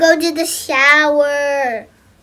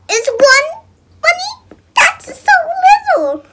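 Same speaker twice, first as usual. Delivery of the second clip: mock cry; 14 semitones higher, 7 dB louder than usual. Kid complains about money.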